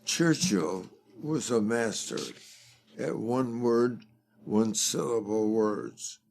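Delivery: ordinary talk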